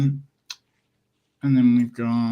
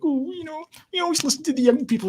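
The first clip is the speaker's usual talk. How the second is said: much higher, prissy politician parody; mocking Sturgeon. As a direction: mimic a smug politician.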